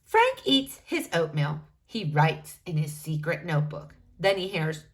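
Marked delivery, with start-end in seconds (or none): none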